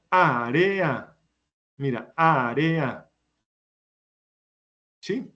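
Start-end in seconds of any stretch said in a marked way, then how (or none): 0.1-1.2 área with its vowels stretched, á-re-a; sounded-out word. Shown as a demonstration. 2.2-3.1 área very drawn out, á-re-a; slow sounding-out. Shown as a demonstration. Teacher repeats the word slowly.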